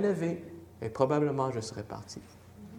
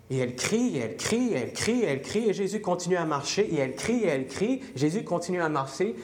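loud, high fervent preaching; preaching at full force; 5 semitones higher, 3 dB louder than usual. Acted. Preacher driving home the woman's persistent cries, fervent and loud. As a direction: drive it home to the congregation.

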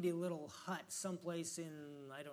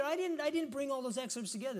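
ordinary talk, nothing marked

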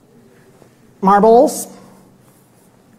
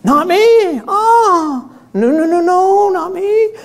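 Peter protesting; 7 semitones higher, much slower than usual. Retold or imitated. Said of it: "Oh, you're gonna desert me then".

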